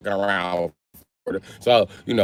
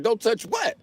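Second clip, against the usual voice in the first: mock bewilderment; 13 semitones higher, 6 dB quieter, slower than usual. His puzzled reply to the warning.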